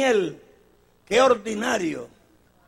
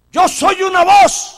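proud declaration; 10 semitones higher, 10 dB louder, slower than usual. Acted. John's answer, loud take.

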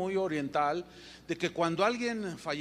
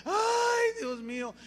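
high theatrical wailing ay, fake sobbing; mock wailing mourner; 5 semitones higher, slower than usual. Preacher imitating paid mourners at a coffin, fake high wailing. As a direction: ham up a hired mourner's lament. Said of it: "se paraban allí junto al féretro y empezaban".

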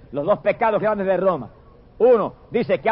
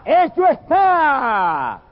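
higher, slow, drawn-out proclamation; mighty voice proclaiming; 5 semitones higher, much slower than usual. Acted. Preacher voicing the temple's mighty voice, slow proclamation. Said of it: "una voz poderosa del templo que gritaba".

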